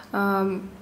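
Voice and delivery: ordinary talk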